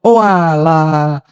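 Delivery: slow, drawn-out "Oh alah" of relief. Retold or imitated. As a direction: sigh the words out in mock relief. Relieved reaction retold. (lega panjang setengah bercanda)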